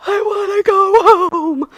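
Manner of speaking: loud, high, drawn-out wail; hysterically crying. Retold or imitated. Patient when the doctor walks in, hysterical crying wail. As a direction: imitate a meltdown, slowly wailing every word. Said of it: "I'm, like, hysterically crying".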